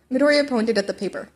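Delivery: usual speaking voice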